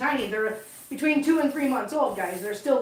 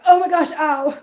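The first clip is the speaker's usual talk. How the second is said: louder, mock-dramatic outcry; imitating hurt kitten; 4 semitones higher, 7 dB louder than usual. Owner acting out the kitten's face plant, mock-dramatic. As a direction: play up the cat's pain in jest. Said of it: "he was like".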